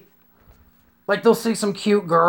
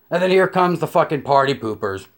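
ordinary talk

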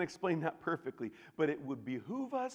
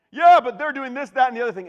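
high, loud, whiny protest; mock the excuse-maker; self-absorbed whine; 12 semitones higher, 18 dB louder, faster than usual.

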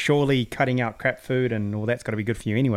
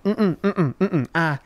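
mimicking nurse grunts; 3 semitones higher than usual. Mocking the nurse who can't speak English, grunting. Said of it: "she's like".

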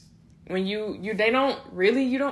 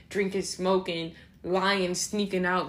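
usual speaking voice

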